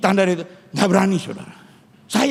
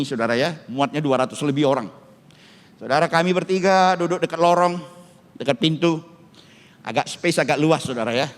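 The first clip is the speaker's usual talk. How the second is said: normal talking voice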